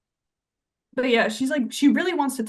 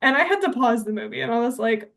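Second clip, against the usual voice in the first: normal talking voice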